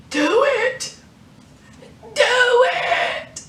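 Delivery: high pleading cries of a choked woman; acted desperate plea. Woman being choked begs him to finish it, high crying plea. Recapping a tense TV scene.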